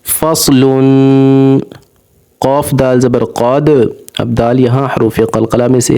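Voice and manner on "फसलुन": फसलुन with a thick, full स, slow; heavy swad. Shown as a demonstration. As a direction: give the स in फसलुन a heavy sound. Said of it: "स्वाद भी मोटा होगा". (फसलुन में स्वाद मोटा)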